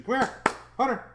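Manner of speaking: high sing-song call to the dog; calling the puppy. Real, not acted. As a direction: coax the puppy over in a high voice.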